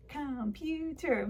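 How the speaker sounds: "Computer" slowly in three beats; syllable by syllable. Shown as a demonstration. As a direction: clap out "Computer", each syllable on its own. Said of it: "a word to clap out".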